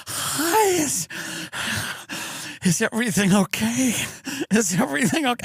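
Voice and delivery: high, anxious checking in; desperate worry. Retold or imitated. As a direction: plead to know if all is fine. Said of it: "You're like".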